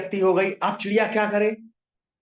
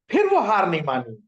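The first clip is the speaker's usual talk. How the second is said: ordinary delivery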